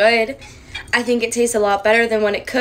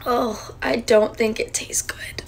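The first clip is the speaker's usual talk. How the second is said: whisper; 4 dB quieter, slower than usual. Real, not acted. Grimacing aside after tasting.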